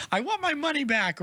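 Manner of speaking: high-pitched mock complaint; indignant kid character. Boy complaining to the wizard, indignant. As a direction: imitate a boy demanding a refund.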